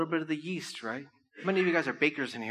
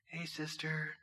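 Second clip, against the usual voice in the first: mock pious greeting; 12 dB quieter, slower than usual. Mocking churchgoers' pious greetings.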